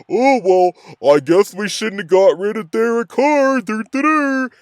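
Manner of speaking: high, loud dopey mock voice; mocking dumb fans. Fan mocking Raiders critics in a high, dopey voice. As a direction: sneer at them like a dimwit. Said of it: "some dumbasses, like".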